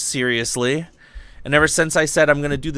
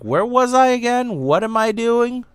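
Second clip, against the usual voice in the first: confused; 9 semitones higher than usual. Retold or imitated. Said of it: "and being like".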